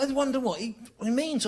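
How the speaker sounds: high, puzzled inner voice; high baffled musing; puzzled wonder. Retold or imitated. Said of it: "I thought".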